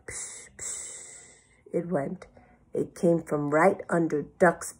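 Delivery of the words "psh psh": soft voiceless psh, psh; whispery imitated monster sound; snoring noise. A narrator's reading. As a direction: breathe out two hushed pshes. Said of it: "Then they heard a sound".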